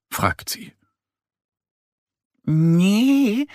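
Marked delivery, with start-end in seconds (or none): 2.4-3.5 timid. Acted. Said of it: "sagt Annie zaghaft".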